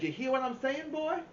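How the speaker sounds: put-on high, loud hillbilly accent, rising on boy. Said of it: "imitation of a hillbilly accent".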